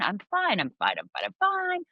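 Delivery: insistent mock reassurance, leaping up high at the end; hammed-up everything's-okay front; put-on fine. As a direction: act out a cover-up, overdoing it. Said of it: "the outside [name], which was fine".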